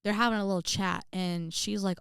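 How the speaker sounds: usual speaking voice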